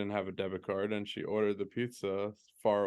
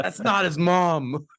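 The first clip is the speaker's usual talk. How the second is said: put-on higher, louder, slow indignant little boy; a child outraged on his brother's behalf; indignant kid; 9 semitones higher, 7 dB louder, slower than usual. Young son protesting that Nana is not the baby's mom, outraged and slow.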